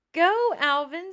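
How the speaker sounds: high, loud cheering scream; big shout of encouragement to her brother. Acted. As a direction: yell it up the tree to him. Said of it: "screamed Annabelle".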